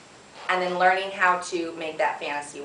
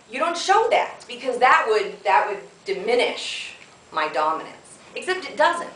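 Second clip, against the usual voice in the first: usual speaking voice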